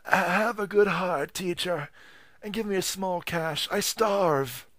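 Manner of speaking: high, slow pleading whine; beggar's whine. Acted. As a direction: beg pitifully for a coin. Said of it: "whined at him".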